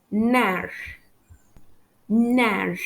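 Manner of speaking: "Nerf" said as "nair", no f; silent F. Shown as a demonstration. French teacher modelling the word, "Nerf" without its f. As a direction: leave the f off "Nerf". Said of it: "I don't pronounce the letter F".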